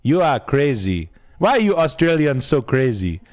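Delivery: put-on lightly done Nigerian accent, drawn out and slow; a hint of a Nigerian priest marvelling at Australians; Nigerian teacher impression. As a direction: play his old Nigerian teacher, amused and exasperated. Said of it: "Mister Obadiah was his name".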